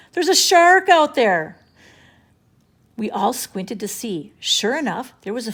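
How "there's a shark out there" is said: panicked shout. A narrator's reading. Beach panic.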